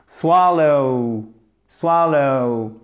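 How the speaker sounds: "swallow" drawn out, long first vowel and gliding final diphthong; model English pronunciation. Shown as a demonstration. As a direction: model "swallow" slowly, letting the ending glide to ou. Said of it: "Donc là, ça nous donnera". (« swallow » articulé lentement, diphtongue finale glissée)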